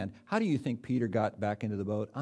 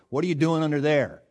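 louder, higher mock-puzzled question, leaning on you; teasing punchline; 6 semitones higher, 9 dB louder than usual. Hendricks teasing a complaining man, loud and higher. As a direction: tease the guy like a wry mentor. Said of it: "Hendricks always say the same thing".

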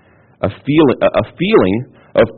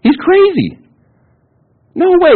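very high, squeaky disbelief; hammed-up scoffing; incredulous protest; slower than usual. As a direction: exclaim in mock outrage, pitch way up. Voices hearers rejecting James's command.

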